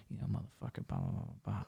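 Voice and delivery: menacing under-the-breath mumble, imitated; barely audible whispered muttering, mimicking her; mumbling. Woman muttering as if she might kill him, mumbled. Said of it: "'cause she started mumbling".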